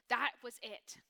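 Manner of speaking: ordinary talk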